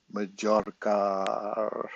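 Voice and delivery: Majorca drawn out very slowly, trying the Spanish sounds; careful Spanish attempt. Shown as a demonstration. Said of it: "isn't it your J pronounced like an H".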